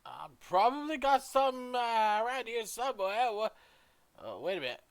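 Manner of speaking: high, loud character voice; pitched well up and projected; shopkeeper character. Acted. Shopkeeper searching her stock for a customer, high and loud.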